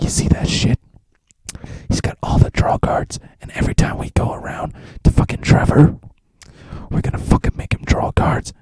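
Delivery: hushed conspiratorial whisper; whispered scheming. Retold or imitated. Narrator whispering his plan to Katniss, secretive whisper. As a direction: breathe it out so Trevor can't hear.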